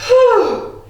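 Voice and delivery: a big high puff of relief; high relieved whew. Real, not acted. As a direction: blow out after the fast song. Out of breath after song.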